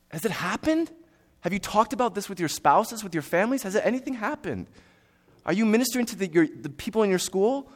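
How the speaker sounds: high-pitched, pressing rhetorical questions; insistent sermon challenge, pitched high; challenging preaching. Real, not acted. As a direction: press the congregation with pointed questions.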